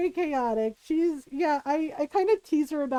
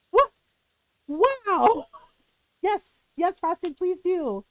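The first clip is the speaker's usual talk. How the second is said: mock sarcastic wow; 5 semitones higher, 4 dB louder, much slower than usual. Ex reacts sarcastically to insult.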